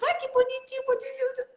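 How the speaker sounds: high cutesy cooing; cooing baby talk. Shown as a demonstration. Host mimics parents cooing diminutives, high cutesy voice. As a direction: coo over how cute it is. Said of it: "desses diminutivos".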